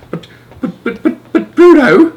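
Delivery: father stammering in shock; stammered. Acted. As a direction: splutter helplessly. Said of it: "stammered Mister Jenkins".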